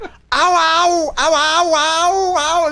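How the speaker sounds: high mock German-accented ow, ow; mock European pain yelps. Mocking a German streamer's pained yelps, high accented ow. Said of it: "scream in pain with an accent".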